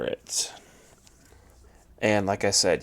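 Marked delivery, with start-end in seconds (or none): none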